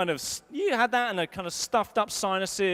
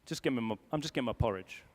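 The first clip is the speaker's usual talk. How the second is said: grumpy mumble; 14 semitones lower, 8 dB quieter, faster than usual. Retold or imitated. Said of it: "I'll be like".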